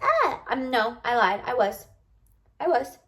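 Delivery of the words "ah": a sudden high happy squeal; excited squeal. Retold or imitated. Showing how bubbly she used to be, high squeal. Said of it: "I wasn't always this, like".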